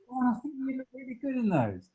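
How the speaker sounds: high, gushing shop assistant voice, lightly mocked; gushing salesperson. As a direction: mimic a salesperson flattering a customer. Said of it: "And you go".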